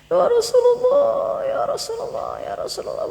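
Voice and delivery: high wail fading into breathy sobs; imitated weeping. Old man at the Prophet's tomb, imitated weeping. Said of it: "Orang itu nangis-nangis".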